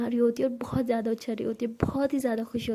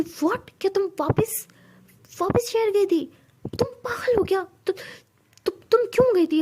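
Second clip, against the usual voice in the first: much higher, louder, disbelieving cry; shocked outburst; 8 semitones higher, 5 dB louder than usual. Acted. Tara horrified that Siya went back to the city. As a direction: explode in alarm.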